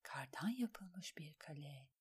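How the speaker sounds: slow, soft whisper; hushed, drawn-out thought; inner thought. A narrator's reading. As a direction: whisper it slowly, musing privately. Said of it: "diye düşündü Alain".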